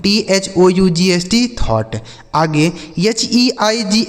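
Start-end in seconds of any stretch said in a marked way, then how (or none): 1.6-2.0 "थॉट" as tho plus t, gh silent; low, falling "थॉट" ending on t; model pronunciation. Shown as a demonstration. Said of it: "यह लिखा गया आपका थॉट". ("थॉट", अंत में केवल ट)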